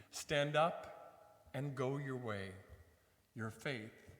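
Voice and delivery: measured, solemn scripture proclamation; Gospel reading. Acted. Priest proclaims Luke to the congregation, solemn and measured. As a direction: read the Gospel aloud to the congregation.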